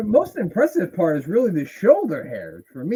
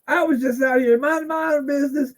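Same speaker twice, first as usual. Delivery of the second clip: higher mock French-accented rambling, voiced as the wrestler; hammed-up French accent, higher; Dutch Mantel impression; 11 semitones higher, 5 dB louder than usual. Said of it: "Dutch Mantel".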